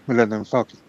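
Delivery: normal talking voice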